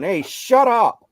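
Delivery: loud high yell, slowed down; angry coach yelling at the ref. Retold or imitated. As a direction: shout it at the ref. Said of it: "I started yelling".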